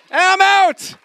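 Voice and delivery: high, loud cry of release; triumphant. Real, not acted. Man declaring he quit his corporate job, high and loud. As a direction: shout it like you just escaped.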